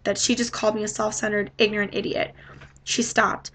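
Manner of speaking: plain reading voice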